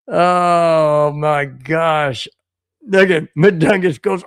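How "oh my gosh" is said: ordinary talk, nothing marked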